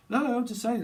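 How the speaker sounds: sudden high, loud protest; high defensive protest. Retold or imitated. To her ex, a high, loud denial.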